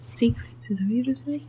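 hushed conspiratorial whisper. Real, not acted. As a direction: whisper it like a secret. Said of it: "Why are we whispering?".

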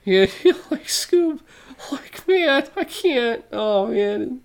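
very high laughing Shaggy voice; Shaggy impression, cracking up. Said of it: "in a Shaggy style".

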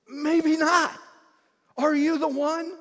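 loud, high, anguished plea of doubt; desperate doubting cry. Retold or imitated. John in prison cries out his doubt loudly. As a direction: cry out the question as if losing hope. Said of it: "he gets right down to the end and he says".

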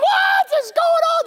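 very high, loud cry; panicked scream. Acted. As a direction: scream in confusion. People screaming in confusion.

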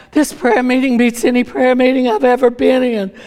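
hammed-up amazement, pitched high; mock wonderstruck disciple; amazed. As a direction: gush like an overawed churchgoer. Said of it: "like, amazed".

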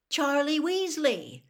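a slow, delighted exclamation of the name; startled realization; surprised recognition. A narrator's reading.

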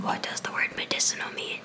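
whispered question, no voice; leaning in to whisper. Real, not acted. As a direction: lean in and whisper it. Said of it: "whisper closer to the microphone".

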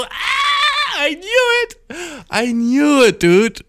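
triumphant shout. Retold or imitated. Telling his ex he was right about the stranger, triumphant shout. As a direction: crow that you were right all along.

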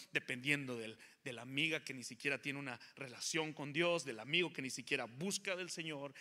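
loud, driving preaching; preaching build. Real, not acted. Preacher warning the church at full preaching force. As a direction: pile up each phrase with force.

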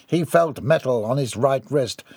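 ordinary delivery, nothing marked

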